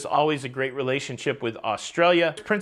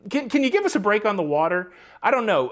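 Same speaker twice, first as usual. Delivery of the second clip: exasperated plea; 6 semitones higher, 4 dB louder than usual. Real, not acted. Pleading with cruise lines.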